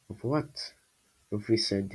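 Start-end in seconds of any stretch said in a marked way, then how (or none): 0.1-0.7 soft, higher, mildly shaky question; voice shaking. A narrator's reading. Frightened Luffy questions the squall, voice shaking. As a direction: ask it timidly, voice a touch shaky. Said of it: "his voice shaking".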